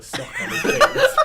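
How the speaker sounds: loud, slow, laughing punchline; laughing confession. Pretend. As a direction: draw out the confession through laughter. Confessing absurd joke, laughter.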